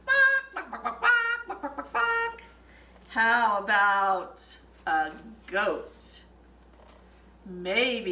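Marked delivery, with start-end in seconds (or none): 0.1-2.4 high clucking chicken noise, much higher. Pretend. Librarian showing kids a chicken, high clucks. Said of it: "I bet my chicken says".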